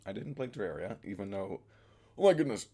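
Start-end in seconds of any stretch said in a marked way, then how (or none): 2.2-2.7 loud, high sudden exclamation; surprised outburst. Real, not acted. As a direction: blurt it out, startled. Sudden outburst mid-sentence.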